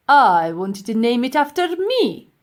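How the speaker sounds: loud, grumbling self-importance; sulky professor. Acted. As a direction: pout about his name. Professor sulks.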